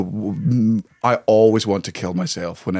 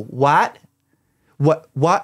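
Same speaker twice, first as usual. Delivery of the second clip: baffled Americans; 5 semitones higher, much slower than usual. Acted. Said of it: "they'll go".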